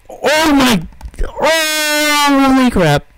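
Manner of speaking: high, loud shriek of shock; startled shriek. Real, not acted. Gamer shrieking at sudden danger. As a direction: screech at the surprise.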